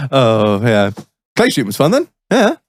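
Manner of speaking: usual speaking voice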